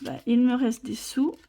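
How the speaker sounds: ordinary talk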